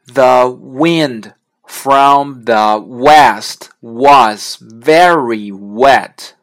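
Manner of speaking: loud, very slow "The wind from the west was very wet"; sentence practice. Shown as a demonstration. Teacher leading practice of a sentence, loud slow word-by-word demonstration. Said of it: "练习一个句子".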